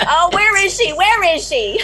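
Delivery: high, loud demand to know; excited calling out. Pretend. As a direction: call out for her, pitched high and loud. Teasing call after his missing wife.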